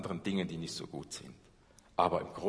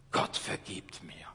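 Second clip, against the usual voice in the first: whisper; 6 semitones lower, 5 dB quieter, slower than usual. Acted. Whispered inner voice of Paulus.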